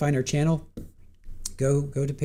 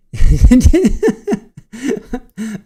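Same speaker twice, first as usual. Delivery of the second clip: loud high laugh; 9 semitones higher, 12 dB louder than usual. Real, not acted. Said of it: "I'm laughing so hard".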